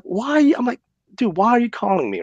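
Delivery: high exasperated why, then fed-up question; exasperated retold why. Man retelling how he snapped at the prank caller, exasperated. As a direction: throw up your hands, dude, why.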